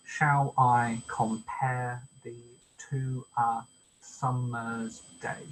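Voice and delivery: slow line with stress on "I", "pare", "to", "sum", "day"; iambic stress. Shown as a demonstration. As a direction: read "Shall I compare thee" syllable by syllable, weak then strong. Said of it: "unstressed and stressed".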